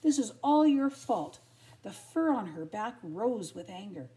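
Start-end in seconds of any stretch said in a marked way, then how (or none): 0.0-1.4 loud, indignant telling-off; cross storybook heroine; angry scolding. A narrator's reading. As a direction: pile on the blame, building louder. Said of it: "she said angrily".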